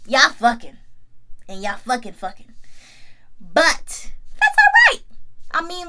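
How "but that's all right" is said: very high, slow, sarcastic "all right". Real, not acted. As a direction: squeak it sarcastically. Sarcastic reaction.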